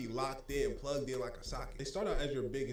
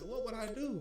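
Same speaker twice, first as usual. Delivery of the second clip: mock innocent surprise; 10 semitones higher than usual. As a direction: voice jumps high in fake innocence. His own retold protest.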